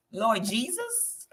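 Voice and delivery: slow, drawn-out puzzled Lord Jesus; baffled plea. Retold or imitated. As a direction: plead to heaven in mock confusion. Baffled reaction to a strange menu.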